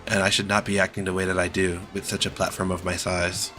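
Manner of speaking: normal talking voice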